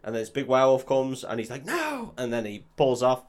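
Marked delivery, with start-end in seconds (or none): 1.7-2.1 mock cry. Tied man cries out at werewolf.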